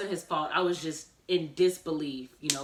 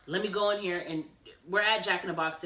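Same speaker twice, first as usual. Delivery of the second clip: ordinary talk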